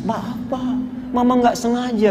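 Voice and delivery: hushed, whimpering apology; mock tearful. Wife kneeling at husband's feet apologises, softly mock-crying. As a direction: plead softly as if in tears.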